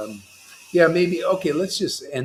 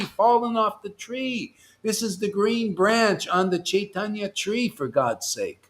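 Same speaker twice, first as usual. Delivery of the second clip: ordinary delivery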